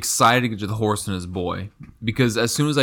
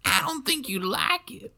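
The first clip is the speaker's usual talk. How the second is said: high, slow, soft cartoon kid voice; put-on high-pitched boy, quieter; Bobby; 6 dB quieter, slower than usual. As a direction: answer as a mock cartoon son, high and drawn out. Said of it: "with the Bobby".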